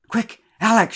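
high, loud storyteller's shout. A narrator's reading. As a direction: call out loud to send a child for help. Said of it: "she shouted".

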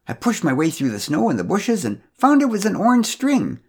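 high, loud busybody telling gossip; scandalised neighbour, rising to a sniff; gossipy busybody. Acted. Pamela's gossipy account.

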